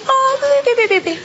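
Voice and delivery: high whimpering sobs, hammed up for laughs; mock crying. Little girl crying after the teacher's insult, mock whimpers. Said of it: "Y yo me fui llorando, así".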